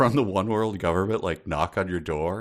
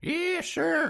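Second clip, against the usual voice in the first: put-on much higher old cowboy sidekick twang; Walter Brennan voice. Podcaster mimics a cowboy sidekick's catchphrase, much higher sidekick voice. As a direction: bark it out like the grizzled sidekick in a Western. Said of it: "the voice that we all know from the cowboy sidekick".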